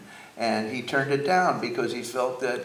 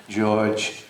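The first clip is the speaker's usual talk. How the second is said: put-on low Upper East Side accent, r's softened away; Harpo Marx; 6 semitones lower, much slower than usual. Son voicing his dad naming his pals, deep Upper East Side accent. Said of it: "He had a, a, an Upper East Side accent".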